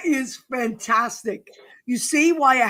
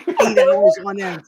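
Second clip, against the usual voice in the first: laughing; 13 semitones higher, 4 dB louder than usual. Real, not acted. Host cracking up.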